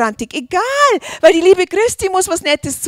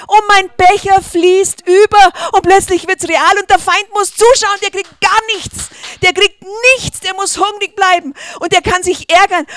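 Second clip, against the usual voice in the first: loud, high, fired-up sermon delivery; triumphant loud preaching; full-force preaching; 4 semitones higher, 7 dB louder than usual. Acted. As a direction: preach it hard to the congregation.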